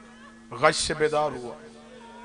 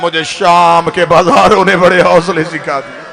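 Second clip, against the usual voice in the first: starts low then swells into a loud wailing cry; grief building to a cry; 3 semitones lower, 8 dB louder than usual. Acted. Sajjad tells his uncle of Sham, building anguished cry. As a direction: let the grief break out louder. Grieving recitation rising to cry.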